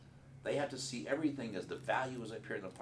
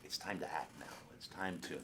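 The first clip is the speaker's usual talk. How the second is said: soft unvoiced whisper; whispered cue; 4 semitones lower, 7 dB quieter than usual. Shown as a demonstration. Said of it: "remember, the green light's on".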